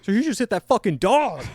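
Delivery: loud and high-pitched, suddenly alarmed; loud startled accusation from the passenger seat; excited exclaim. Retold or imitated. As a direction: blurt it out as if you just saw a dog get hit. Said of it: "James was like".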